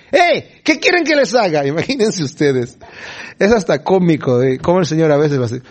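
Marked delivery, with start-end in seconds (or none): 0.1-1.7 high-pitched acted holler; grito desde lejos. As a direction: call out as if across a distance. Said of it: "les gritó desde allá". (grito imitado, agudo, desde lejos)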